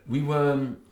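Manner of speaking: normal talking voice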